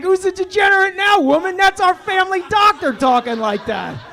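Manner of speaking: high, loud gloating yell; triumphant shout. Retold or imitated. Husband gloating at wife after doctor agrees, shouting. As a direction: crow your victory at her.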